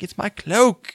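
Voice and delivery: lightly put-on British accent for the cloak line. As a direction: play the girlfriend with a made-up British accent. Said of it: "she has a British accent for no reason".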